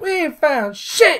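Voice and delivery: high, drawn-out comic yell; slow, exasperated mock shout; shouted movie quote. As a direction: holler it back across a distance. Acts shouted reply from Spaceballs scene.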